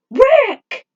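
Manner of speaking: very loud, high, desperate call; alarmed shout. Acted. Falcon seeing Rick crash, alarmed shout. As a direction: cry out in alarm as a friend crashes.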